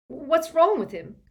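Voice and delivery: high, loud frightened croak; croaking, terrified. Acted. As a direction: croak it out in fear. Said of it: "croaked Fred".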